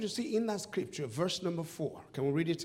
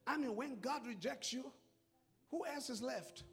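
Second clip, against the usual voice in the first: hushed storytelling; 9 dB quieter than usual. Acted. Quiet retelling of the rejection.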